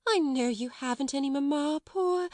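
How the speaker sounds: high, rising pitying coo; soothing sympathy. A narrator's reading. Miss Amelia pitying motherless Lottie, coaxing. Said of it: "then in quite another tone".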